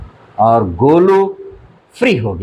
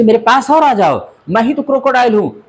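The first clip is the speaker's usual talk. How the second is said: crocodile coaxing; 8 semitones higher, faster than usual. Acted. Sly crocodile luring the child.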